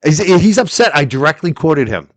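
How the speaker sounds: normal talking voice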